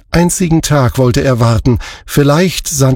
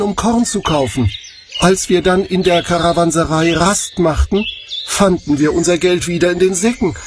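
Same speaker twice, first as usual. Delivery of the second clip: higher, softer, worried explanation; anxious pleading; 5 semitones higher, 4 dB quieter than usual. A narrator's reading. The brothers plead with the Egyptian steward, anxious and higher. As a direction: plead your innocence nervously. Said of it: "klagten sie dem Ägypter".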